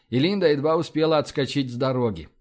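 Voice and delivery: usual speaking voice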